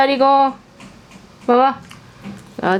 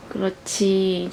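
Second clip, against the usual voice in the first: soft praise; 5 semitones lower, 7 dB quieter, slower than usual. Real, not acted.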